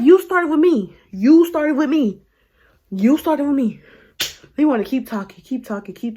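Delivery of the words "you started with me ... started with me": louder, higher, hard-hit accusation; retold angry retort. Telling the man he started it, loud retold accusation. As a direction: throw the blame back forcefully.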